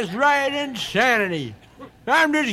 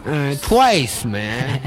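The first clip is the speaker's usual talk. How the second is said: stoned hippie voice; 9 semitones lower than usual. Acted.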